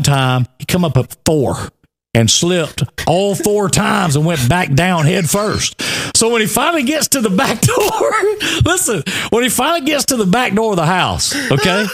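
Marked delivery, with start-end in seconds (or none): 6.2-11.9 high-pitched, pushing on through laughs; stifled laughter. Real, not acted. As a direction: keep telling it while fighting real laughter. Laughing while trying to continue story.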